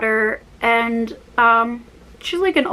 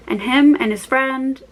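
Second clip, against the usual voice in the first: ordinary talk, nothing marked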